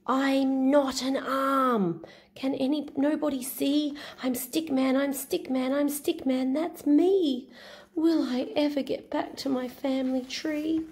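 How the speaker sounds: high, sing-song little stick hero; Stick Man voice. Acted. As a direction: chant the refrain brightly, stretched out. Stick Man's refrain.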